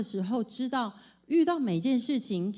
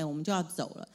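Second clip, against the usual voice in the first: ordinary talk, nothing marked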